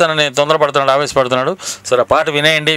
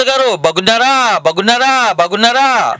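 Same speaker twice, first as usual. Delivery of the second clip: calling out; 7 semitones higher, slower than usual. Real, not acted. Repeating greeting over bad line.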